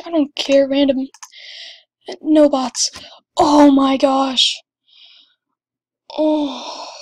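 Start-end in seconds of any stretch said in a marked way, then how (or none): none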